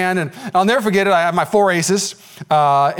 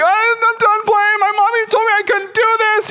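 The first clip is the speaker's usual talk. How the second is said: put-on much higher, slow whiny child voice; whiny little kid. Beaten friend quitting the game, much higher childish whine. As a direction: whine like a kid quoting his mommy. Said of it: "he's like".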